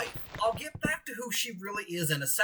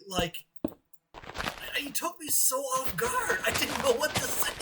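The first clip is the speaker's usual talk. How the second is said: ordinary delivery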